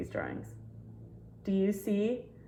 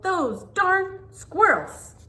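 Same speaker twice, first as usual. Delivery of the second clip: put-on high, slow, shouted old-man grumble; grumpy old man yell; 12 semitones higher, 6 dB louder, much slower than usual. Old man yelling at the thieving squirrels, high slow shout. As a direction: shake your fist and bellow it slowly. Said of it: "he shook his old man fist and yelled".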